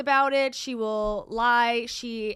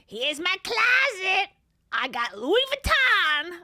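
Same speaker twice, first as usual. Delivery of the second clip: higher, slow mock Boston accent; mocking the influencer's fake Boston accent; 9 semitones higher, 4 dB louder than usual. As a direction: show off the closet in a put-on Boston accent. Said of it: "that's a bad accent".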